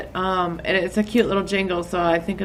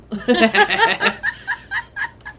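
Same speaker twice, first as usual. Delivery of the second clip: high squealing laugh; 14 semitones higher, 4 dB louder than usual. Real, not acted. Laughs at rant.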